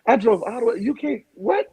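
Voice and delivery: sister's furious rant, pitched high; ranting high-pitched at her brother like an enraged sister; livid sister. Retold or imitated. Sister yells at drunk comedian brother, high furious rant. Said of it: "She was livid".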